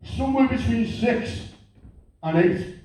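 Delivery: slow, drawn-out dim hard man; dim Scouse bloke. Retold or imitated. Huge gym bloke telling the comedian how many reps, slow and dim. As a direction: pause long over the numbers like it's hard sums. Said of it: "I kid you not, he went".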